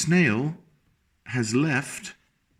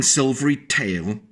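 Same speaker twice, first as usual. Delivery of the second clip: usual speaking voice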